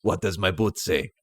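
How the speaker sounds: a hint of Belgian action-star impression; Jean-Claude Van Damme. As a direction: lightly imitate the accented action hero. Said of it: "very good Jean-Claude Van Damme impression".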